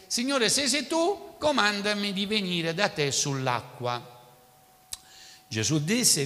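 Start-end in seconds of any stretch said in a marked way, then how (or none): 0.1-4.1 higher, louder call from the boat; a lightly voiced Peter calling across the water.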